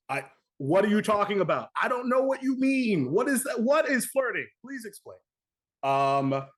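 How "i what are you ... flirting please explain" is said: higher, wide-eyed fake innocence; pretending not to get it, high and innocent; mock clueless. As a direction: play dumb about flirting. Feigning ignorance about flirting.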